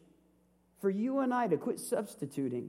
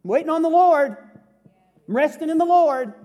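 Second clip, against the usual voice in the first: loud, high, showy proclamation of waiting on God; pious shout; 7 semitones higher, 11 dB louder than usual. Shown as a demonstration. Pastor mocks idle faith for the congregation, in a loud showy shout. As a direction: belt it out like a preacher showing off his piety. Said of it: "What I'm about to demonstrate is the faith that doesn't work".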